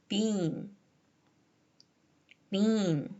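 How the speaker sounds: "been" with nasality deliberately held back; forced non-nasal vowel. Shown as a demonstration. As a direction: say "been" alone, forcing the vowel to stay oral. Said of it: "억지로 눌러서".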